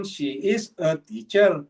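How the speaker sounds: "She is a teacher" said slowly in English, she with sh sound. Shown as a demonstration. Preacher to congregation, slow English demo of "She". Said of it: "ini bacanya she".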